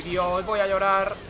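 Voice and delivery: ordinary talk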